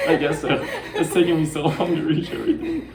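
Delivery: high giggle through the words; laughing. Real, not acted. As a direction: laugh while you talk. Laughing at ASMR remark.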